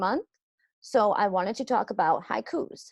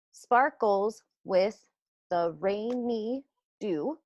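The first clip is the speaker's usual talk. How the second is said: slow, each syllable spaced out; slow syllable-by-syllable teacher count; much slower than usual. Shown as a demonstration. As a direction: tap out every syllable slowly for the class. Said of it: "let's count those syllables".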